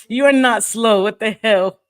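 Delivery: high, loud amused reassurance; laugh, then object loudly; playful loud disbelief; laughing protest. Real, not acted. Laughing protest to viewer's self-deprecating comment.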